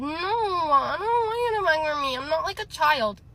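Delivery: high, wailing mock refusal; exaggerated bratty wail, long swooping vowels; childish whine. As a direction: whine it like a protesting kid. Mocks boyfriend refusing help childishly.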